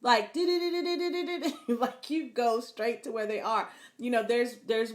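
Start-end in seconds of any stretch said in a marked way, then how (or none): 0.4-1.6 a quick bright giggle bubbling up; amused laugh. Real, not acted. Preacher laughing at spouses tracking phones, amused giggle. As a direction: laugh at how absurd the spying is.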